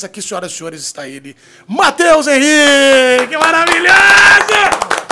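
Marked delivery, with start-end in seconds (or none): 1.7-5.1 slow, drawn-out booming announcement; announcer shout. Acted. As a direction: call him into the ring. Fight-style guest announcement.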